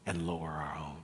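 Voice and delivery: hushed whisper to mirror lowering; dropping to a whisper. Shown as a demonstration.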